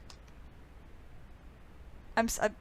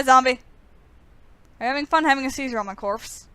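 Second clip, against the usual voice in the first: ordinary talk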